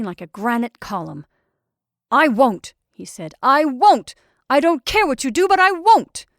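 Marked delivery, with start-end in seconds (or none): 2.1-2.7 loud, firm refusal; stubborn refusal. A narrator's reading. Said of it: "highly exasperated". 3.4-6.3 loud, raised-pitch defiance; stubborn, angry refusal. A narrator's reading. Acted heated quarrel, refusing.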